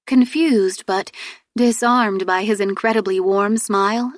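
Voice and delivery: ordinary delivery, nothing marked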